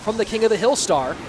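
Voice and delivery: live play-by-play. Acted. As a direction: ride the comeback to the win.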